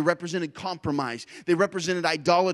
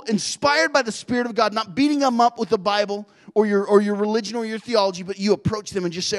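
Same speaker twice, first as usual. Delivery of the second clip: ordinary talk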